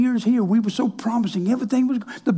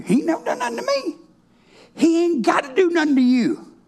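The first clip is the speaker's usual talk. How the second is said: excited; 8 semitones higher than usual. Acted. Preacher rising into exclamation.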